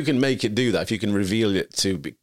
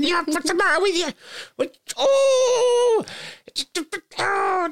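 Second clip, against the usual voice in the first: very high squeaky little voice; tiny screaming creature. Acted. Podcaster acting an infuriated invisible creature revealing itself, squeaky mock screaming. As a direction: shriek it like a furious tiny imp. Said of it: "screaming, a little, I, I always say".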